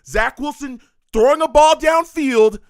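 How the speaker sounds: hopeful excited call. Real, not acted. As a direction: call the throw as it flies. Live play call, ball in air.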